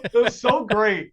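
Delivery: usual speaking voice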